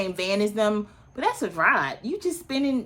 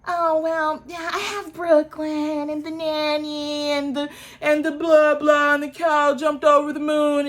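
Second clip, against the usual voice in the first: high, droning imitation of rambling excuses; high sing-song blah-blah mockery; mocking excuse; 6 semitones higher than usual. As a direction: parody someone reeling off feeble excuses. Mocks Kenya's rambling excuse.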